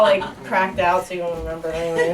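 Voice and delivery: ordinary talk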